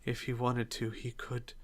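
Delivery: very soft, frail voice; frail, defeated murmur with pauses; weakly. Acted. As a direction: speak faintly, with little strength left. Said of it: "Azil in Finn's arms very weakly".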